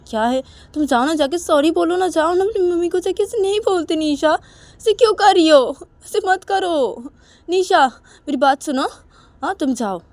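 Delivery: high, loud insistent appeal; urgent pleading. Acted. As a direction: beg her loudly to stop this and go. Manisha ज़ोर देकर समझाती है. (ऊँची आवाज़ में गिड़गिड़ाकर समझाते हुए)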